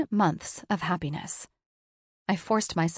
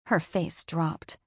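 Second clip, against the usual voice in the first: plain reading voice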